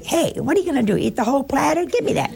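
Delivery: brash, high Italian family banter; mock Italian grabbing the spaghetti; hammed-up Italian at dinner. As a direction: tease them over the platter, high and pushy. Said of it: "We'd say".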